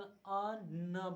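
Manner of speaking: slow, split अ न into two sounds; a slowed, separated अ न; slowly, sound by sound. Shown as a demonstration. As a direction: say अ and न apart, slowly. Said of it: "अंग को हम धीरे से बोल".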